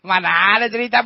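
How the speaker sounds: normal talking voice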